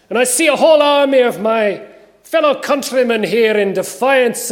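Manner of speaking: put-on loud, high battle oration in Scottish accent; rally the troops with an attempted Scottish burr; William Wallace. Warrior addressing an army of fellow countrymen, rousing Scottish accent. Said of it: "my attempt at a Scottish accent".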